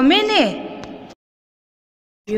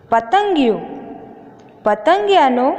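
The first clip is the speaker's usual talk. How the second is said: ordinary talk, nothing marked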